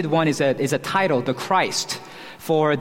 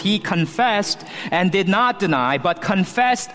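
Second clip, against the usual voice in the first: higher, punched "confessed" and "not deny"; emphatic; 4 semitones higher than usual. Shown as a demonstration. Preacher piles on the verbs of John's confession, emphatic. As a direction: pile up "confessed", "did not deny", "confessed", each one stressed. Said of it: "So John piles on the verbs".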